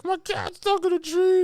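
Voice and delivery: put-on very high, whiny child voice; little girl. Little girl asking for help with her cat, very high and whiny. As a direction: pipe it up like a crying kid.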